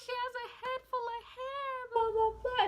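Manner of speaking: high-pitched excited chatter; imitated excited onlookers. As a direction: exclaim in a high voice, imitating the room. Retelling everybody's exclamations at birth.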